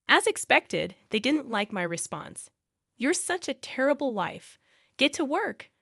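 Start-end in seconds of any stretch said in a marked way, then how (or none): 3.0-5.7 higher-pitched, mildly annoyed scolding; annoyed snap. A narrator's reading. Mother-in-law orders wife to clean, annoyed snap. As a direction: snap at her with a touch of annoyance. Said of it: "my mother-in-law snapped at me, clearly annoyed".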